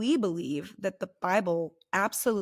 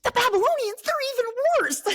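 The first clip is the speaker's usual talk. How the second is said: very high, louder mock-appalled squawk; outraged protest; 5 dB louder than usual. Prophet objecting to God's plan, hammed-up shrieking disbelief.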